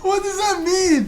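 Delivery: high, laughing question; high laughter. Real, not acted. Laughing fit at the text.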